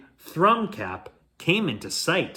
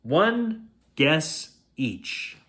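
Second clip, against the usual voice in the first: slow and deliberate; much slower than usual. Acted. Mermaid sets the riddle.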